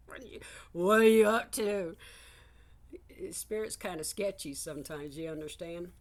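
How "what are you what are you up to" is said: loud stage whisper, half laughing; suspicious loud whisper. Retold or imitated. Asking sketchy Spirit what it is up to, loud suspicious whisper. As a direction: hiss it like you suspect a prank. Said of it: "part of me is like".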